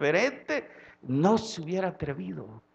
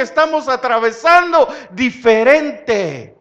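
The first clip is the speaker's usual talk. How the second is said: loud, driving sermon voice, much louder; full-force preaching; 9 semitones higher, 13 dB louder than usual. Acted. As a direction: preach it out to the congregation.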